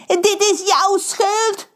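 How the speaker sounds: high, slow, blaming shriek; shrieking accusation. Acted. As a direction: point and shriek the blame. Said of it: "gilt Pedro". (Pedro gilt beschuldigend naar Reus)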